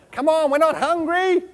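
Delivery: high, laughing, slowed teasing. Real, not acted. Speaker to eating audience, high laughing tease. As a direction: laugh it out in disbelief.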